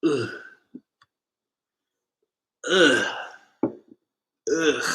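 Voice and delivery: strained groans as the liquor burns; groaning after the shot. Real, not acted. Just downed the shot on the live, burning groans.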